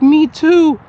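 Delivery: lightly imitating her; mock woman voice. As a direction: drag out her me too. Voicing her reply.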